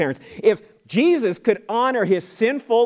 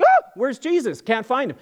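higher, mildly hammed-up alarm; alarmed parent; 6 semitones higher than usual. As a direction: act out a parent suddenly missing a child. Parents notice child is missing.

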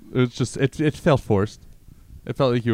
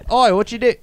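high, loud Cockney "Oi" put on; British dad impression; 12 semitones higher, 7 dB louder than usual. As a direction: bark it like a rough British dad. Imitating friend's British dad.

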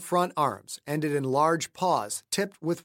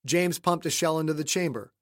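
ordinary delivery

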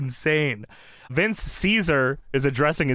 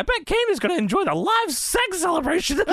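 Jerry Lawler impression; 13 semitones higher than usual. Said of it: "Jerry Lawler says".

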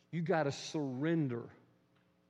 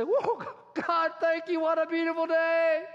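joyful outburst; 14 semitones higher, 8 dB louder, slower than usual. Pretend. Acting out joyful thanks.

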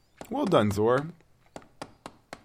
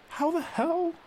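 disbelief; slower than usual. Acted. Losing at chess.

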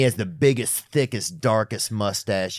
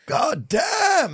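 father's shout. Retold or imitated. Guest imitating his father's constant cursing, shouted high. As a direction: bark it like a grumpy dad. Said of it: "what my father used to say".

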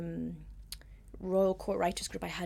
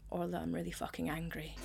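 quiet nervous outburst; 2 semitones lower, 8 dB quieter than usual. Retold or imitated. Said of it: "it was probably very quiet and very nervous".

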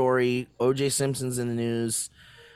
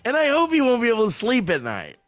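mock outrage; 8 semitones higher, 3 dB louder, faster than usual.

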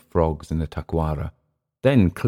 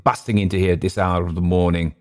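plain reading voice

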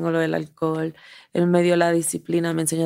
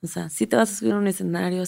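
ordinary delivery

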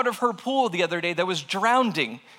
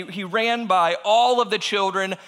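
plain reading voice

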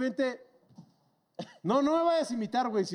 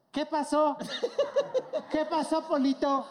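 higher-pitched imitation of a clueless culprit; mock bewildered driver; 4 semitones higher than usual. Storyteller voices the driver peering out at the damage, mock bewilderment. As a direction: call out, playing dumb after a crash.

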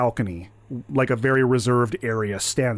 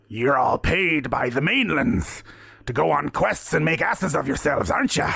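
taunting pirate captain; 3 semitones higher than usual. Acted. Captain mocking the questers before the tavern, taunting. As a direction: jeer at them like a pirate captain.

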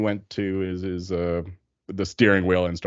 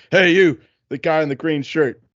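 loud, raised-pitch mock bus-driver call; driver going off; 7 semitones higher, 5 dB louder than usual. Passenger imitates bus driver singling out a rider, going off. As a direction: imitate a driver calling out a passenger, easing after the first words. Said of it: "driver just starts going off".

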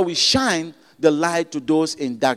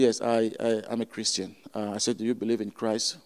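much softer, lower-voiced admission; quiet confession; 7 semitones lower, 8 dB quieter than usual. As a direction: say it almost under your breath.

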